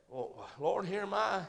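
soft, low Well, Lord, here am I; humble reply. Pretend. Congregation answers God, soft and low. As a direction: answer gently, almost under breath.

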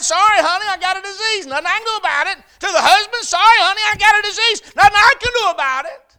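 high, shouted mock excuse; full-force preaching.

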